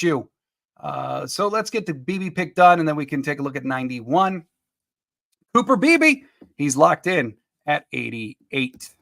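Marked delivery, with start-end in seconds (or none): 5.5-6.2 excited announcement. Real, not acted. Announcing a locked-in draft pick.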